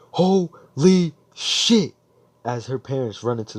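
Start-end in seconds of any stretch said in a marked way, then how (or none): 0.1-1.9 high, loud, very drawn-out mock shock; stunned exclamation. Girl seeing her idol unmasked, stunned. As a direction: stretch out each syllable in acted disbelief.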